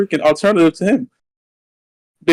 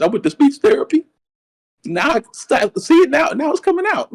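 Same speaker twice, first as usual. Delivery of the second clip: higher, mock-wounded whine about his lisp; hammed-up dramatic; 12 semitones higher than usual. Teased host whines to cohost, mock dramatic. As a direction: play the hurt victim, overdone. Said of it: "You're so dramatic".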